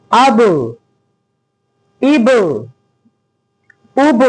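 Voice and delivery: अब, इब, उब with an echoing bounced ब; qalqala recitation. Shown as a demonstration. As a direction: bounce the ब once so it rings. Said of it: "बस आवाज गूंजेगी". (गूंजती कलकला)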